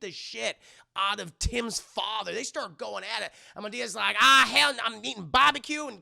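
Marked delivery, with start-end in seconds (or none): 4.2-5.9 loud, high imitated protest; mock indignant outburst. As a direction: holler it like a hammed-up complaint. Voices Medea's outburst in a retold brawl.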